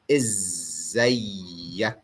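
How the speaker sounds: "إزيك" slow, with a long doubled z; "إزيك" drawn out, izzayyak, rising at the end; Egyptian Arabic. Shown as a demonstration. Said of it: "That's why I wrote it twice".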